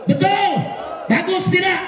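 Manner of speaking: high, insistent call-and-response; rallying crowd questions. Real, not acted. Preacher asking the crowd about the rally, insistent. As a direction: throw each question out for a roar back.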